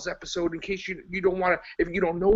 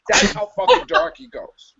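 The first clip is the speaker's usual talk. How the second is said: louder, punched "fucking" in "That's how fucking dark he goes"; emphatic punchline; 5 semitones higher, 8 dB louder than usual. Real, not acted. Ranting to his co-host, loud emphatic punchline. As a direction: slam "fucking" louder and fall hard on "goes".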